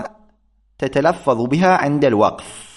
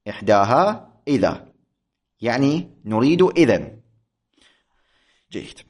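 ordinary talk, nothing marked

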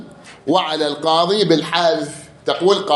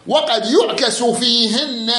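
تلاوة بطيئة مرتفعة النغمة للمتن; رتّل بيت المتن ببطء; قراءة المتن; 6 semitones higher, much slower than usual. Acted. يقرأ متن النحو. (قراءة متن ملحونة ببطء)

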